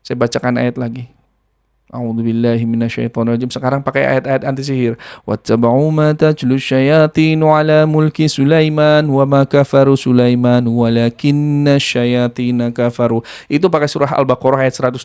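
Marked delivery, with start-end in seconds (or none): 1.9-13.2 Quran recitation. Acted. Reciting anti-sorcery verses.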